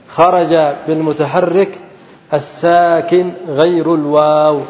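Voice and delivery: slow, drawn-out dictation; measured teacher's restatement with long vowels. Shown as a demonstration. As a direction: spell out the rule slowly, word by word. Teacher restates rule for students.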